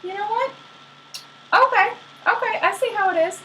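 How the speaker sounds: high-pitched, put-on huffiness; squeaky-high mock anger; mad, mock indignation. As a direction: pitch it way up, acting offended. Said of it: "She was so mad".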